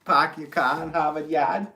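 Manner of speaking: loud, high hammed-up Boston accent. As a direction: belt out a caricature Boston accent, r's dropped. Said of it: "trying to do the Boston".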